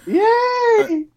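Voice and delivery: high, loud yay; excited cheer. Real, not acted. Cheering the Satanic story.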